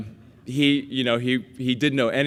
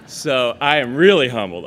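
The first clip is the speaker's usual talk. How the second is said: laughing; 4 semitones higher, 10 dB louder than usual. Real, not acted. Laughing after the sister joke.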